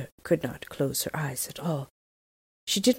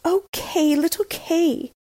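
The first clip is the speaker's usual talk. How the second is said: sighing; 12 semitones higher, 4 dB louder, slower than usual. A narrator's reading. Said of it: "sighed Gerda".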